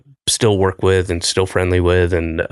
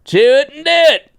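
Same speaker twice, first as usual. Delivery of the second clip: Southern accent; 5 dB louder than usual. Shown as a demonstration. Host redoing the ad tagline in a Southern accent. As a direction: belt it like a hammy Southern pitchman. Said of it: "say that again in a Southern accent".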